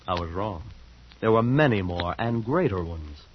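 dreamy, unhurried storytelling voice; story narration. A narrator's reading. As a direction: read it like a fairy tale.

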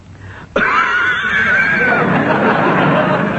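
choking cough. Acted. Drinks strong medicine.

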